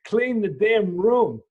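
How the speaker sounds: father at the end of his patience, slow and furious; slow angry order. Retold or imitated. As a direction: lay down the law word by word. Dad scolding son over room.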